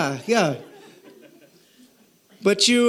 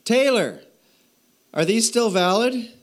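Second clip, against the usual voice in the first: daughter's voice; 8 semitones higher than usual. Retold or imitated. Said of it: "She said".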